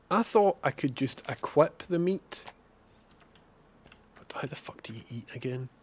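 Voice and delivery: normal talking voice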